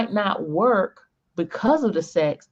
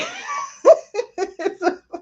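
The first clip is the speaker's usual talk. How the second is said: high laugh; 14 semitones higher than usual. Real, not acted. Big laugh at the joke.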